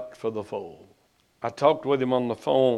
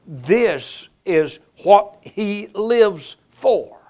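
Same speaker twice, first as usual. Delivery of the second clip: usual speaking voice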